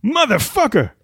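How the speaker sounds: high, tough movie-gangster voice; Samuel L. Jackson impression. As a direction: growl it like a badass gangster. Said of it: "speed round".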